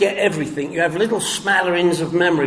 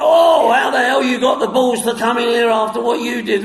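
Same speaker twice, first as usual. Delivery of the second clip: mock outraged shout; 6 semitones higher than usual. Restaurant staff yelling at him on return, outraged shout. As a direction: bark it like an angry barman.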